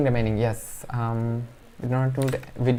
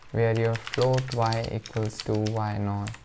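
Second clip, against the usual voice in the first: usual speaking voice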